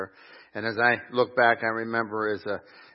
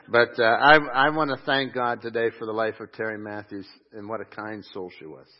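ordinary talk

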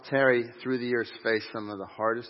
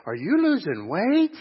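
much higher, slow, sing-song mock question; teasing old lady; 11 semitones higher, 7 dB louder, slower than usual. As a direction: imitate a sweet old lady fishing for news. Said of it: "she'd go".

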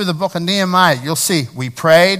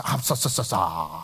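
Fonz stammering; 8 semitones lower, 6 dB quieter than usual. Retold or imitated. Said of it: "You'll be like the Fonz".

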